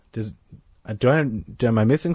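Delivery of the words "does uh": ordinary delivery, nothing marked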